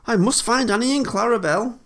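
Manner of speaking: high, loud, panicky excuses; flustered exclamation. A narrator's reading. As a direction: make hasty excuses in a very high voice. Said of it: "exclaimed Thomas".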